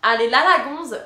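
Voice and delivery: loud, punchy mock call; imitated squirrel's heckle. Squirrel hearing her talk about him, loud mock heckle. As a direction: shout it as a cheeky squirrel. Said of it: "Il a dit".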